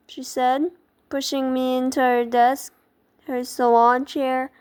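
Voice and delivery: ordinary delivery, nothing marked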